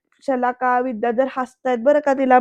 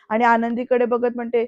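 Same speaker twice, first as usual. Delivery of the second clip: plain reading voice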